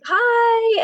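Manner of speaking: chirpy high hi back; returning a stranger's eager hello, high and chirpy; high excited greeting. Retold or imitated. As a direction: squeak the hello back brightly. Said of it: "And we were like".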